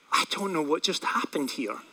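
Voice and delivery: hushed, soft bewilderment; low-key dazed disbelief; stunned. Retold or imitated. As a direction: say it quietly, almost to yourself. Stunned after witnessing conversion.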